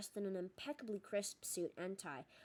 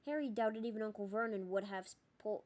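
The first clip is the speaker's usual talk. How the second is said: plain reading voice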